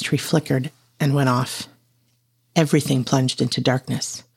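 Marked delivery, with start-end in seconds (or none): none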